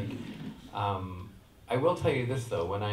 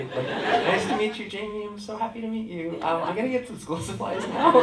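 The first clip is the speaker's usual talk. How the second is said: higher, louder, over-polite greeting; politely awkward brush-off; 13 semitones higher, 8 dB louder than usual. Retold or imitated. Dad greeting an overwhelmed fan while shopping, awkwardly polite. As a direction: play up the awkward niceties, retold.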